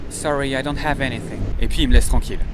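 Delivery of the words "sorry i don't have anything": put-on show-off native English accent; best English accent. Frenchman proudly refusing money in England, best English accent. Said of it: "je prends mon meilleur accent pour dire".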